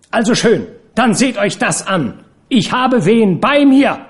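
high-pitched, furious reveal, slowing at the end; defiant announcement. Acted. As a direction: fling the secret at them, loud final words. Furious woman reveals her lover.

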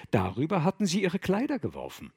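usual speaking voice

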